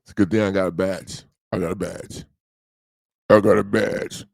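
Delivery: low creepy menace. Acted. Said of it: "that was my creepy low voice".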